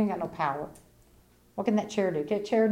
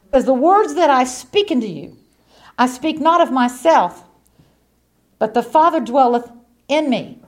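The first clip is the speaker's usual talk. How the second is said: louder, weighty scripture quote; scripture proclaimed; 3 semitones higher, 7 dB louder than usual. Acted.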